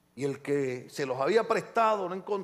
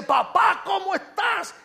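high, loud, delighted hail; excited greeting; 11 semitones higher, 6 dB louder, slower than usual. Retold or imitated. Pastor spots an old friend after many years, excited greeting. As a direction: greet a long-lost friend at full lift.

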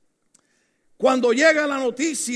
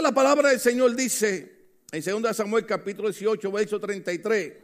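normal talking voice

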